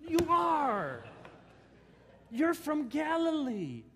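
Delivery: high, loud, slow insisting; insistent bystanders. Retold or imitated. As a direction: hammer home where he's from. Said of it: "bystanders insisting".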